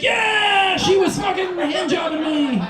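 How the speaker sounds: high, loud boastful shout; hammed-up victory holler; mock triumphant yell. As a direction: crow it out to the whole room. Acts out bragging in reality.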